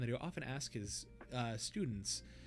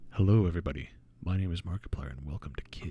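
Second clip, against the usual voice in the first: low, breathy ASMR-style intro; close soft whisper; 7 semitones lower, 9 dB louder, faster than usual. Retold or imitated. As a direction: whisper it right into the mic. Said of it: "very close and very soft with his".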